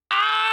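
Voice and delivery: sudden very high, very loud shouted "Ah!"; mock scream. Streamer mocking a loud design that screams at him, mock scream. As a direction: yell it right in someone's face. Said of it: "looking at me in the face and going".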